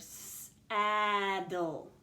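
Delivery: "saddle" as sss-aaa-dul, first sound held apart; stretched out. Shown as a demonstration. Teacher asks pupils to listen to the word, "saddle" stretched out. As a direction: hiss the s of "saddle", then hold the vowel. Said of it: "Let's listen to that word".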